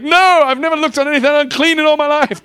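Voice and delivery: high, loud indignant refusal; shouted protest. Acted. Prim believer refusing God, shouted protest. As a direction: protest at the top of your voice.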